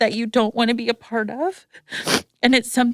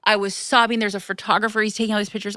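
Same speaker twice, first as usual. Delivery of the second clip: ordinary talk, nothing marked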